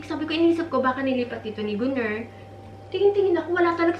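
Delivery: normal talking voice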